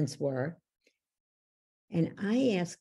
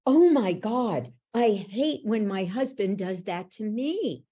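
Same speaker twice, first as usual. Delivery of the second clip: mom's realization; 6 semitones higher, 5 dB louder than usual. Retold or imitated. Mother realizing husband ignores her, higher and louder. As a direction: let the recognition hit her.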